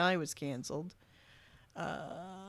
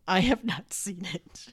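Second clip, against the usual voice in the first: laughing whisper. Real, not acted. Laughs at own confession.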